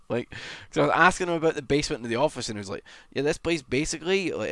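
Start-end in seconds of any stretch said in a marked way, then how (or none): 3.1-4.3 lightly mocked Welsh accent, pitched higher; imitated Welsh accent. Streamer quoting Daff on the office, imitated Welsh accent. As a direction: imitate a colleague's Welsh lilt. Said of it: "in his, like, Welsh accent".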